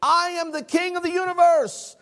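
very high mock-regal yell; grandiose, hammed-up proclamation; yelling madman. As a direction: bellow it high, like a chest-beating king. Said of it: "beating his chest and yelling".

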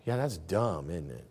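low, soft, embarrassed murmur; sheepish realization. Retold or imitated. Young husband to himself, low sheepish murmur. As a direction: mumble it, caught out. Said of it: "And I'm like".

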